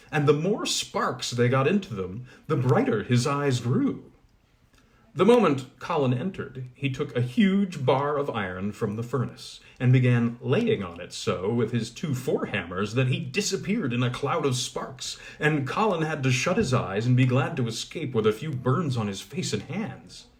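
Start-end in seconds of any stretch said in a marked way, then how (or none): none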